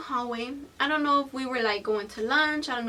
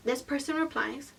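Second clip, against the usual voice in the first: usual speaking voice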